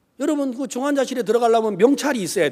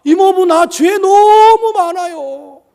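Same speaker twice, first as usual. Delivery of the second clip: loud, high, drawn-out wail of confession; imitated confession, pitched up and stretched; niece confessing; 10 semitones higher, 11 dB louder, slower than usual. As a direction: cry it out high and slow as the niece. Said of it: "그 죄가 있다고 고백을 하더라고요".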